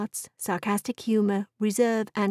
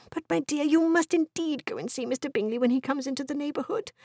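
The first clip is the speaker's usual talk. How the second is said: higher-pitched coaxing wife; pleading wife; 6 semitones higher than usual. Acted. Wife urging her husband to visit Mister Bingley, pleading.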